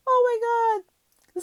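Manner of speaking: high, drawn-out, worn out from laughing; slow squeal after a real laughing fit; helpless with laughter. Real, not acted.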